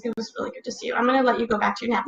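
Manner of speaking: ordinary delivery, nothing marked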